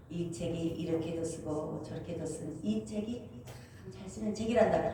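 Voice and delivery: Jesus reassuring. Retold or imitated. Jesus voiced in testimony.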